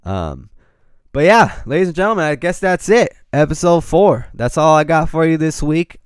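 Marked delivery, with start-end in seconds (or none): 1.1-6.0 bright, loud show outro; announcer sign-off. Real, not acted. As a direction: wrap it up like a radio host.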